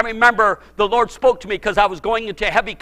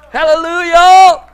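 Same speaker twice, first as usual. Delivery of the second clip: high, loud, slow; preacher shouting praise after the story; shouted hallelujah; 6 semitones higher, 7 dB louder than usual. Real, not acted. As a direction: lift it up in praise.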